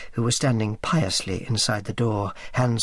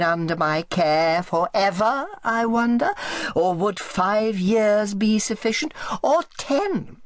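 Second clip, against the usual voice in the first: whining, creaking aunt; 9 semitones higher than usual. Acted. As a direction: creak it out like a frail old tyrant. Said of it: "Her voice whined and creaked".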